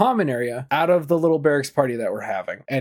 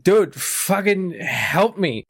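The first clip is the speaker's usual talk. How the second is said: imitating a friend's drawn-out, higher-pitched plea; retold plea for help; 5 semitones higher, much slower than usual. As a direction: slowly beg for help, mock-pitiful. Injured friend begging for help.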